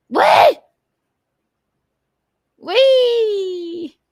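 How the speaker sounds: high, loud squealed whoop; playful squeal. Pretend. Host amusing the stream between chats, high playful squeals.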